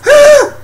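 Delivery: very high, loud shriek; short squealing comic scream; mock terrified scream. They spot the alien, mock scream. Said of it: "they're all like".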